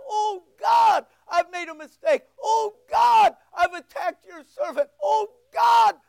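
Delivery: high, loud cries to God; desperate prayer. Acted. King confessing to God on a sleepless night, desperate crying out. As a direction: cry out in acted anguish.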